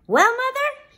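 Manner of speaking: put-on high, loud boyish greeting; triumphant Jack. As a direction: crow proudly to mother. Jack returns triumphant with gold.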